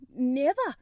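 loud, startled Yorkshire cry; a maid gasps out her disbelief, loud; startled exclamation. A narrator's reading. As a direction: blurt it out in shock. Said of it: "she exclaimed".

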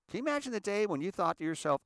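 usual speaking voice

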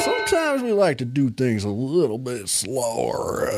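cowboy voice. Acted. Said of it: "a fair amount of cowboy voice".

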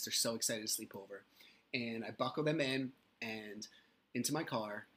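ordinary talk, nothing marked

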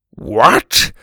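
loud, rising burst of surprise; shocked. Acted. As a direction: blurt it out in disbelief. Grandpa shocked by the news.

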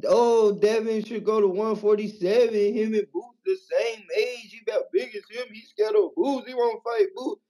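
high, whiny mock of the haters' talk; mocking the haters. Caller mocking the Devin haters, whiny high mimicry. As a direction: parrot the doubters with a sneer. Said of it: "But to all you Devin haters".

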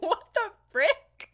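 high, drawn-out comic bafflement; mock disbelief. James hears that Sev read minds, mock disbelief. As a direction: draw it out high, playfully stunned. Acting James's stunned reaction.

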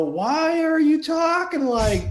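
very high, loud, drawn-out imitation; exaggerated high mocking question; mock condescending retort. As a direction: throw the patronizing tone back, high and slow. Acted retort, mimicking that tone.

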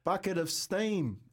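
slow, high, earnest bucket of steam; naive repeat. Retold or imitated. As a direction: say it innocently, drawn out. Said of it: "And I went".

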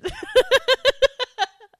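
high cackling snicker; a naughty little cackle; mischievous laugh. Real, not acted. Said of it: "That last laugh was so mischievous".

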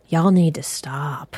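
hushed, stern whisper; whispered warning. Acted. Scolding chat spoilers.

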